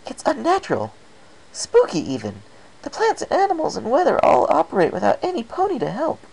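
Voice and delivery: wide-eyed excitable mare hyping up a scary forest; Pinkie's voice. Acted.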